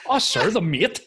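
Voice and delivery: "mate" said as "meet", high and loud; hammed-up Northern Irish "the mate" as "the meet", high; mimicking "mate". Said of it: "You're like".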